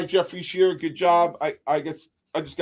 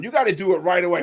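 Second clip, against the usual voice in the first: normal talking voice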